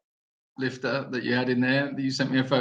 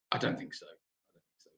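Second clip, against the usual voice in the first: whispered aside, much softer; 11 dB quieter than usual. Real, not acted. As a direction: breathe it as a sly aside. Teasing reply to troll question.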